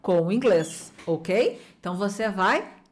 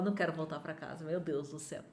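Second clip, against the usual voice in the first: mock dread; 6 semitones lower, 13 dB quieter, much faster than usual. Child dreading going home.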